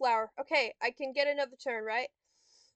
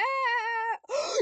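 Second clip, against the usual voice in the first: high, drawn-out gasp; loud indrawn shock; 10 semitones higher, 3 dB louder than usual. Real, not acted. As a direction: gasp in outrage. Reacts to opponent's move.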